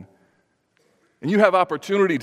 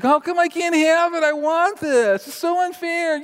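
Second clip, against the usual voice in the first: put-on little boy whining at his mom in a store; whining child; 3 dB louder than usual. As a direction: whine it like a seven-year-old denied a toy. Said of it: "he started whining".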